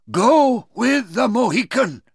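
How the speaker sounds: put-on high-pitched old chief's order; old chief commanding. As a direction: give the order in a high aged voice. Old chief commands young men.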